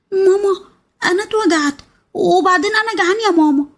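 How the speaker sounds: put-on high childish voice, complaining of hurt and hunger; little boy's voice. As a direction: whine to mum like a small child. Narrator voices the small hurt son.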